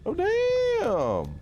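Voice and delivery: high, stretched "damn" in surprise; surprised shout. Real, not acted. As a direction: yelp it way up high. Reacting to a big drop.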